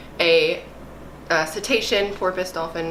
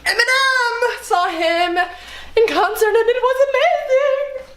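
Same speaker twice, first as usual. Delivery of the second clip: high, loud fangirl gush; gushing with delight; excited squeal; 13 semitones higher, 4 dB louder, slower than usual. Real, not acted. Fangirling over [name].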